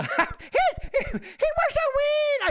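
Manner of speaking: high, slow, crooning wee toad lad voice; silly storyteller voice. Pretend. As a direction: coo it like a Scottish granny. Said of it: "I don't know why I did that voice".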